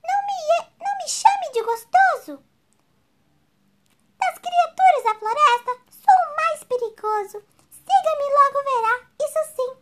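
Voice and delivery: high, loud indignant little mouse; indignant boasting mouse. Acted. Mouse boasts to the gruffalo, indignant and proud. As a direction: puff up like a brave tiny mouse.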